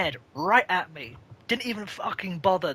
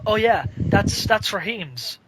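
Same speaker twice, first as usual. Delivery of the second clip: loud, slow and sure of himself; eager answer; 5 semitones higher, 7 dB louder, slower than usual. Retold or imitated. To the big family at their table, proudly naming the customer. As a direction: announce it like everyone knows him.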